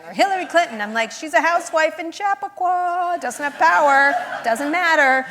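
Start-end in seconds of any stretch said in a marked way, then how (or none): 1.1-5.2 exasperated retort. Retold or imitated. Arguing back at her mom.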